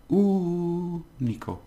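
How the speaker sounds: único said slowly with the first syllable high; stress on Ú. Shown as a demonstration. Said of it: "stress on here".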